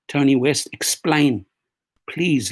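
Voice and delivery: normal talking voice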